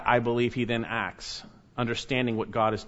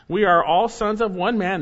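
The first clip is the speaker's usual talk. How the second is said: louder, higher pleading claim; insistent protest; 7 semitones higher, 7 dB louder than usual. Acted. Brothers pleading with Joseph, loud and insistent. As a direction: insist desperately on your innocence.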